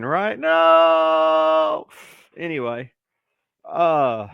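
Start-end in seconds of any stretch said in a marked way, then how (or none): 0.4-1.9 long, loud held mock wail; loud, hammed-up groan of refusal; inner cry of refusal. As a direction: stretch NO into a comic howl. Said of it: "that's what I'm thinking".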